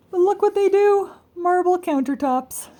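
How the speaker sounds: gasp, then high and loud; surprised gasp. Real, not acted. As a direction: show the joke with delight.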